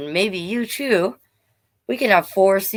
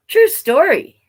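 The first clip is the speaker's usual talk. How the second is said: emphatic; 10 semitones higher, 7 dB louder than usual. Acted. Emphatic aside while reading.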